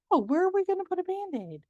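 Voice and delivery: high, quick, delighted question; playful eagerness. Retold or imitated. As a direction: sound thrilled to play along. Playing along with little girl.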